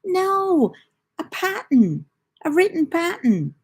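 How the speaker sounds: high, loud retold insistence; exasperated correction. Mum retells correcting her confused son, exasperated. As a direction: re-enact spelling it out, exasperated and emphatic. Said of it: "I'm like".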